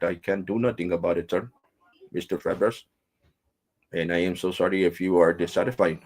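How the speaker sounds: put-on apologetic Indian call-centre accent; mimicked Indian English, polite and placating; imitated Indian agent. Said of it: "I'm talking like he was talking to me".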